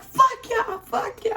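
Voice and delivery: very high, loud retold cursing; high-pitched enraged outburst, acted out; mock furious yell. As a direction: scream it at them, reenacting rage. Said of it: "I was so mad".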